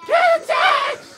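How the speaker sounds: high, drawn-out urgent yell; shouting. Real, not acted. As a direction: scream it at your teammate.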